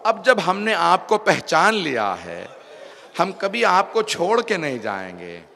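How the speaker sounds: loud, slow declaration of loyalty; preacher voicing a fervent pledge; impassioned preaching. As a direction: draw out the vow with rising force. Impassioned retold vow of loyalty.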